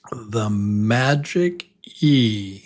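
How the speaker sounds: slow teacher emphasis; slow emphatic naming. Shown as a demonstration. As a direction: draw it out slowly, savoring each word. Teacher savors favorite topic.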